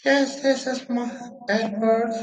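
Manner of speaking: high falsetto caricature of a man on the phone; imitating womanly voice. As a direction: imitate the father sounding like a woman. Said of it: "he sounded like".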